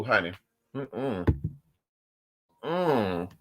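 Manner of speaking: ordinary delivery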